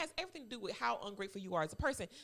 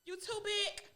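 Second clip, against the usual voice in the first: mocking taunt; 9 semitones higher, 4 dB louder than usual. Real, not acted. Mocking Jada, repeated taunt.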